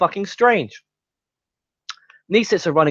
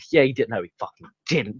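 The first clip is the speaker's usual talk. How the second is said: normal talking voice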